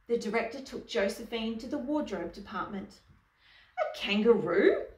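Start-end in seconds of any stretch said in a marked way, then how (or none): 3.8-4.9 loud question swooping up at the end; affronted costume designer, storybook style; incredulous exclamation. A narrator's reading. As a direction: exclaim in acted disbelief. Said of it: "exclaimed the costume designer".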